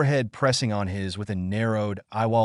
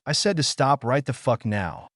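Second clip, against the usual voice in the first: plain reading voice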